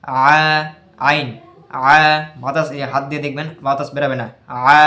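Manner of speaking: আ and আইন said with a firm push in the throat, no breath escaping; firm voiced আ from the throat, air held in; jahr demo. Shown as a demonstration. Tajweed teacher demonstrating jahr on আইন, firm throat sound. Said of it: "একবার দেখিয়ে দিই কীভাবে উচ্চারণ করতে হবে".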